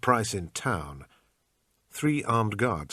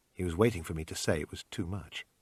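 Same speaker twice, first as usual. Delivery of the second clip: plain reading voice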